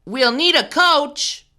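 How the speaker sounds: put-on a boy asking the class around; Tyler's voice. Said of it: "asked Tyler".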